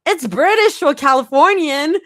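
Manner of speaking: high, loud, laughing argument. A narrator's reading. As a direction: argue it through laughter. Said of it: "Zoe laughs argues".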